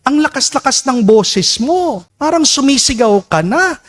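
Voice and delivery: high, put-on old woman's voice; old woman complaining. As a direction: play the complaining old lady at mass. (boses ng matandang babaeng nagrereklamo)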